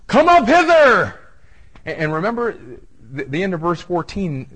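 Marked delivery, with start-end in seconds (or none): 0.1-1.2 high, loud shout from heaven; commanding shout. Acted. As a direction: shout the summons slowly and high. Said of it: "and he shouts".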